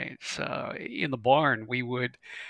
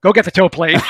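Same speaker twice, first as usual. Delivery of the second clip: higher, louder call to a buddy; calling out an order; 5 semitones higher, 13 dB louder than usual. Retold or imitated. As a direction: holler it across the hangar. Owner orders helper.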